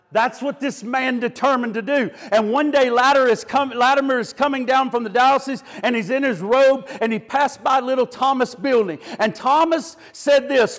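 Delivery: ordinary delivery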